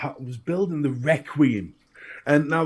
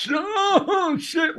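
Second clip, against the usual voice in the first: high-pitched mock shriek; pained yelp. Builder zapping their thumb on a live coil, acted high yelp. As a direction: re-enact a sudden startled cry. Acts out pressing the button.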